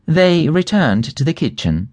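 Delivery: plain reading voice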